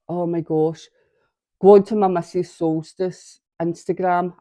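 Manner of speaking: ordinary talk, nothing marked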